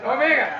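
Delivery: Omega loud with long opening O; Greek pronunciation. Shown as a demonstration. Guest repeats it for learners, emphatic Greek Omega. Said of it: "can I say it in Greek?".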